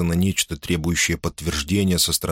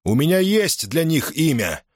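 firm declaration; 9 semitones higher than usual. A narrator's reading. Julius answers Crassus firmly.

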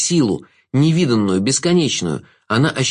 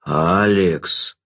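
low, drawn-out warning from the Jester; low reproving call of a name; mild warning; 5 semitones lower than usual. A narrator's reading. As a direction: chide her in a lower voice. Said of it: "погрозил он ей пальцем".

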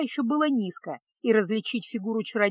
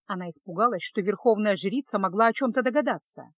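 ordinary delivery, nothing marked

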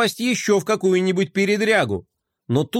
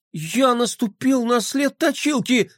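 испуг; 8 semitones higher than usual. Acted. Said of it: "мальчик опустил глаза и ахнул".

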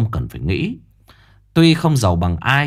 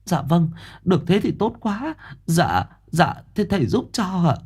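higher, grateful woman; relieved mother; 8 semitones higher, 4 dB quieter than usual. Acted. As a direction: thank the master with relief.

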